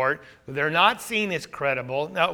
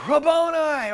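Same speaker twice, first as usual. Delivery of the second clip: cried out; 8 semitones higher than usual. Retold or imitated. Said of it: "She turned to him and cried out".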